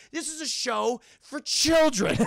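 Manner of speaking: loud, high, slow exclamation; drawn-out amused outrage; laughing disbelief. Real, not acted. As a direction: exclaim incredulously, breaking into laughter. Streamer's disbelief, breaking into laugh.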